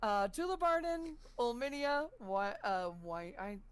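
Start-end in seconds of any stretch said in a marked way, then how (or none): none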